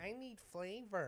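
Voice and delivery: whiny. Retold or imitated. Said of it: "I was like".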